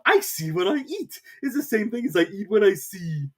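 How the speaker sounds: high, odd lecturing voice; Hatter. A narrator's reading. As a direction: lecture her mockingly.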